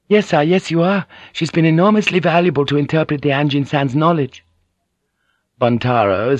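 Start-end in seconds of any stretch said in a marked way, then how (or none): 0.1-4.4 put-on lightly raised, courteous young woman; gently voiced dutiful niece; polite young woman. Fujiko hastily agreeing with uncle.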